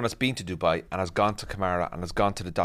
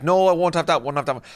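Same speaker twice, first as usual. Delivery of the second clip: higher-pitched, louder mum turning it down; imitated refusing mum; 7 semitones higher, 3 dB louder than usual. Mum refusing bags on video call.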